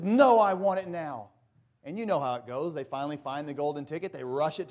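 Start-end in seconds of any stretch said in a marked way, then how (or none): 0.0-1.3 loud, slow spoiled-brat insistence; bratty demand. Retold or imitated. Veruca refusing her father, loud slow bratty demand. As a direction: stamp out each word like a spoiled child. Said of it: "And she says".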